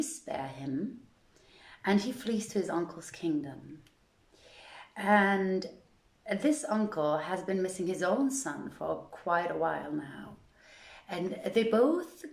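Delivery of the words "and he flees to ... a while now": ordinary delivery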